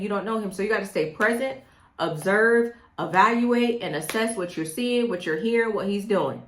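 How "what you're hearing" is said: ordinary delivery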